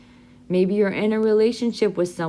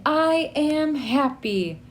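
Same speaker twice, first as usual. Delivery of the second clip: slow, higher happy chant; happy model phrase; 5 semitones higher, much slower than usual. Shown as a demonstration. Teacher leading students to say it happily. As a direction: lead the class slowly and happily. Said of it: "So everyone say".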